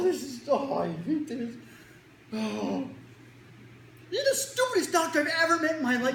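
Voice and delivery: put-on high, slow whimpering kid half laughing; mock distressed kid. Frightened kid berating the doctor, high teary whine. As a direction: play a kid on the verge of tears.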